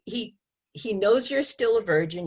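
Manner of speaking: normal talking voice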